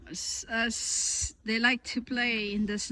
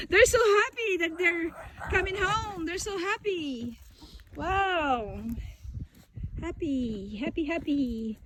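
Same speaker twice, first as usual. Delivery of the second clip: pet talk; 6 semitones higher than usual. Real, not acted. Talking about the excited dogs.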